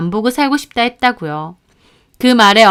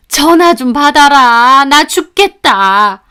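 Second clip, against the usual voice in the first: put-on slow, loud, pleading old woman; whiny mother-in-law; 3 semitones higher, 6 dB louder, slower than usual. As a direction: drag it out like a guilt-tripping mother-in-law. Mother-in-law's text voiced. (며느리에게 전화 받으라고 조르는 시어머니, 느리고 큰 목소리)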